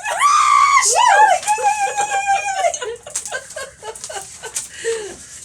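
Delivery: long, loud, high celebratory shriek; excited squeal. Real, not acted. Host gets excited over a quiz win, long excited squeal. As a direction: shriek with glee and let it tail off.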